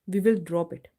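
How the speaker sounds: normal talking voice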